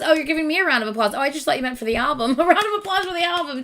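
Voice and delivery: ordinary delivery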